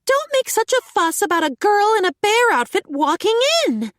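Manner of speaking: high, loud telling-off; roared. A narrator's reading. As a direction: roar at the whole guild. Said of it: "Sonya roared".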